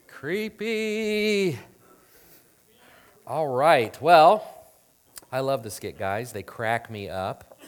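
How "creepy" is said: high, drawn-out word; playful creeped-out singsong. Pretend. Preacher joking about the doll head, playful high singsong. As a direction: stretch it out high like a teasing chime.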